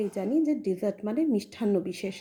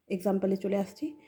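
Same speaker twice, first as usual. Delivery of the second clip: ordinary talk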